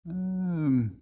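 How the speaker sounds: put-on hesitant old man, head shaking; old gentleman doubts the bargain, hesitant; doubtful old gentleman. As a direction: weigh the risk aloud. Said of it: "said the old gentleman, shaking his head".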